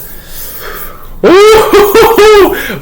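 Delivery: high, bursting laugh of pure delight; excited laugh. Real, not acted. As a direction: let a big delighted laugh break out.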